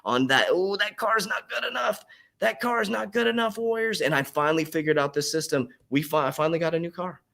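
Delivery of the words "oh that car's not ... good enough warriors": higher, taunting sing-song; mock tempter voice. Coach mocking the system that sells cars, higher taunting voice. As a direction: tease like a salesman luring them.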